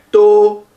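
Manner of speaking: "to" with a closed O as in "l'eau", held high; O circonflexe. Shown as a demonstration. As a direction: sustain "to" loud, high and even. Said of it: "c'est comme le O de Paul". (« to » avec un O fermé, tenu haut)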